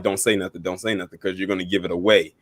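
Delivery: low, softened retold warning; hushed warning. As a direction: drop the voice for a retold caution. Retelling a hushed warning to stay silent.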